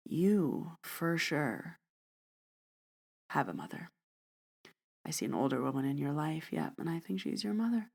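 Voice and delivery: soft palm reading with pauses for suspense; mock palm reader. Telling a man at a bar very generic stuff, mock mystical.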